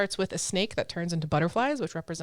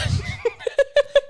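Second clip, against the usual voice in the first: loud high laugh; 8 dB louder than usual. Real, not acted. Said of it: "Even I'm offended by that".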